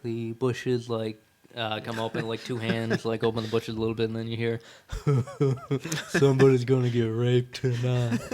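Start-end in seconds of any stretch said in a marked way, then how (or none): 4.9-6.0 sinister chuckle from hiding; creepy laugh. Acted. Creepy voice from the bushes. 6.1-8.2 leering, laughing menace; a sinister voice lurking in the bushes; creepy stalker voice. Acted.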